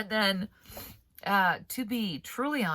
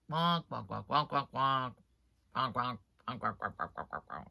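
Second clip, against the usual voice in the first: flat robotic wonks, fading lower and softer; monotone computer voice; 5 semitones lower, 5 dB quieter than usual. Retold or imitated. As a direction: drone it like text-to-speech, sinking away. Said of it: "there's no cadence, there's no inflection".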